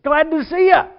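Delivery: loud, high call; hearty greeting. Acted. Churchgoer greeting a touchy man, loud hearty greeting. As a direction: boom out an exaggerated greeting. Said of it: "all you did is walk in and say".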